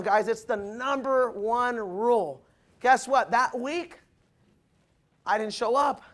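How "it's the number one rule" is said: slow, weighty, every word landed; preacher hammering the key point; slow emphatic rule. Real, not acted. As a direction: drive the rule home slowly. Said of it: "Write that down".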